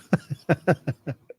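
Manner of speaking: real laugh. Real, not acted. Streamer cracks up at a chat joke, laughing. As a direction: burst of helpless giggling.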